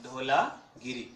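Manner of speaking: "धोलागिरी" drawn out syllable by syllable; teacher slowly repeating "धोलागिरी" for students to note; slow spelled-out name. Shown as a demonstration. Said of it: "इसमें नाम आया आपका धोलागिरी".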